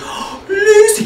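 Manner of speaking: squealing, drawn-out high coo; gasp, then gush in a very high voice; imitated high-pitched excited greeting. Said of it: "Get this really high-pitched voice".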